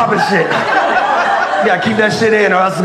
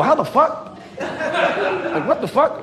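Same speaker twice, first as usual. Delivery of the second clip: mock disbelief; 7 semitones higher, 5 dB quieter, much slower than usual. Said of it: "And y'all go".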